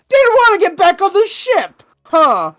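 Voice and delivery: very high, loud taunting; hammed-up loud bullying; mock intimidation. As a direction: shout it as a jeering guard. Acted guards taunting the runaway.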